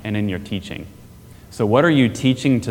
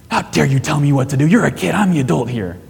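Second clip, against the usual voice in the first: indignant mock outrage, quick and a touch louder; a hammed-up offended dad snapping at his kid; prideful parent; 5 semitones higher, 3 dB louder, faster than usual. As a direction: bristle like a wounded proud parent. Imagined prideful parent reacting to kid.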